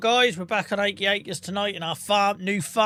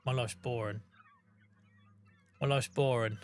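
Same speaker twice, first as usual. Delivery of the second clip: low, soft, deadpan moan; mock gloom; 5 semitones lower, 7 dB quieter than usual. Telling chat there is no news, in mock gloom. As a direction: sell the boredom with a sigh. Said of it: "deadpan self-pity".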